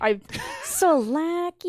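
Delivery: teasing sing-song echo; mock her complaint playfully. Mocking friend's complaint.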